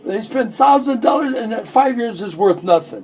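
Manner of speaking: excited rant. Real, not acted. Ranting about investments.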